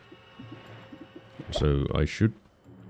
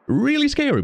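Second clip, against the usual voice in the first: high emphatic. Real, not acted. Emphatic fear talk.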